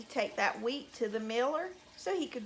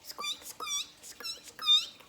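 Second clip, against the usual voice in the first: very high little squeaks; mouse squeaking; much slower than usual. Shown as a demonstration. Storyteller showing children what a mouse says, tiny high squeaks. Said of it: "What does a mouse say?".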